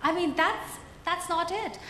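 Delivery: usual speaking voice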